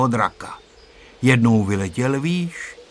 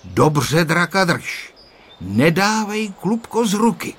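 stern; 6 semitones higher, 3 dB louder than usual. A narrator's reading. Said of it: "řekl mu přísně".

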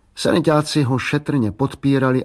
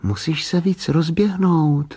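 put-on higher, slow girl's voice; little girl advising; 6 semitones higher, slower than usual. A narrator's reading. Little girl silently advising her brother, little girl advising. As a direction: coach brother in a high, drawn-out voice. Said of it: "radila v duchu holčička Bráškovi".

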